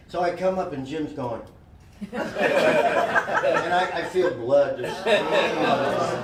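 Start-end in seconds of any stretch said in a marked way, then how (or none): 2.0-6.2 laughing through the words, louder; real laughter breaking into the telling. Real, not acted. Laughing while telling of bloody pool dive. Laughing at his own injury.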